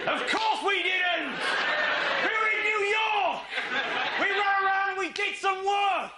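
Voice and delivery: high, loud shout, slow at first; indignant shout. Acted. To the audience, a mock-outraged high shout. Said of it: "comic outburst".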